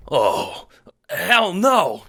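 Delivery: high, loud cry of dismay; anguished cry. A narrator's reading. Renegade discovering the loss, anguished. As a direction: cry out in horror, pitch leaping up. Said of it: "you cry, dropping the bucket in anguish".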